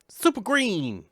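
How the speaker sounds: squeaky high-pitched excited shriek; Chris Tucker impression. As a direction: go way up high like a hyper movie sidekick. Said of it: "I can't get that high".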